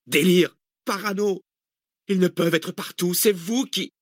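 high, agitated cries of disbelief; angry outburst. A narrator's reading. Passenger pounding the seat at her companions, angry outburst. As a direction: lash out with the pitch far up.

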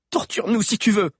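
high, loud defiance; defiant vow. Acted. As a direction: hurl it at the witch. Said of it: "jura Valère". (défi crié, aigu et fort)

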